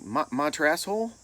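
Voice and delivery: imitating a baffled coach, rising at the end; coach misreading roster. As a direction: stumble on the name, then ask it loudly. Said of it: "he's like".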